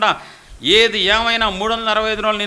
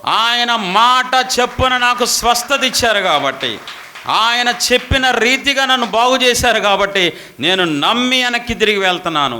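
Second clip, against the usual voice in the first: joyful shouting; 3 semitones higher, 6 dB louder than usual. Acted. Healed leper answering the interviewer, shouting joyfully. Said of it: "ఎందుకు నువ్వు అరుస్తున్నావు?".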